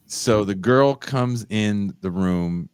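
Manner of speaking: ordinary talk, nothing marked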